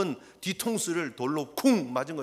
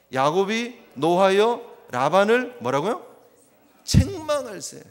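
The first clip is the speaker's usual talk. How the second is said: slow, weighty scripture reading; drawn-out emphatic verse reading; 7 dB louder, slower than usual. Shown as a demonstration. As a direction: lean on every word. Preacher reads verse, emphatic.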